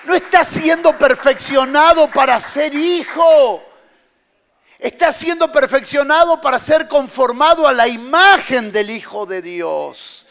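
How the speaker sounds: loud, high preaching shout; preaching at full force. Acted. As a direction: cry it out over the congregation, slowing on key words.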